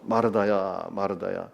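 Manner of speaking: low, softened calling of a name; gentle admonishing. Acted. Jesus calls Martha twice to counsel her, gentle admonishing. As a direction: call her name tenderly, falling away softly. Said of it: "타이르는 거예요".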